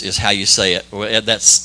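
ordinary talk, nothing marked

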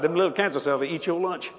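ordinary talk

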